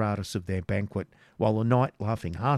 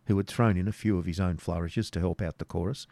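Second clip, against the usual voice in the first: usual speaking voice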